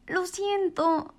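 a gentle sad apology; sighing penguin. A narrator's reading. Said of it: "suspiró la señora pingüino".

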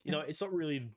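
hammed-up exaggerated Australian; over-the-top Australian accent; broad Aussie.

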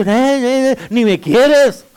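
loud, drawn-out mock wail; imitated whining child. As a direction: wail like a child feeling unloved, hammed up. Imitates children complaining to parents.

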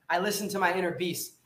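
normal talking voice

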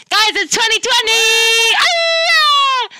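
high, slow, shouted excitement; hyped shout. Acted. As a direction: yell it like a party host. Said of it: "that was maybe even worse".

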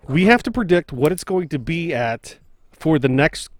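normal talking voice

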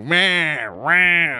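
"meh" as a mocking crowd noise; imitated heckling. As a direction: mimic drawn-out wordless jeering. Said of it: "they're, like, heckling".

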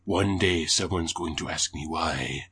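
a light Jamaican-sounding Isaac, slowed; lightly voiced brooding Isaac in a Jamaican tinge; Isaac impression. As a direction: play Isaac with a hint of Jamaican lilt. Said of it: "that's not Isaac's accent".

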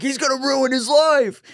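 high mock-panicked friend; hammed-up high-pitched alarm; flipping out. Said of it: "they're all flipping out".

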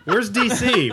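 calling out, completely lost; lost confused traveler. Retold or imitated. Acts out lost Chad.

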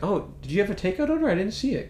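chirpy diner waitress asking. Retold or imitated. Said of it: "she's like".

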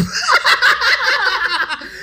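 very high squealing laugh; laughter. Real, not acted. Laughing at the barista joke. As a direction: burst out laughing.

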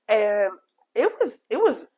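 usual speaking voice

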